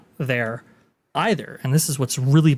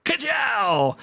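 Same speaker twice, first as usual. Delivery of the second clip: Lightning McQueen catchphrase; 10 semitones higher than usual. Pretend.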